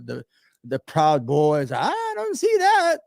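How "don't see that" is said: incredulous denial. Real, not acted. To the host, in high-pitched disbelief. Denying militias exist, heated.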